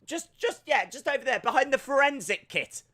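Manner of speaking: high, hammed-up shout across a room; pretend calling an assistant. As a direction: order an imaginary helper about.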